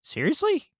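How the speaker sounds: high-pitched incredulous question; imitated disbelief. Cohost quoting people hearing about allergies, incredulous squeak. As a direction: mimic someone taken aback, voice leaping up. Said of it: "And they're like".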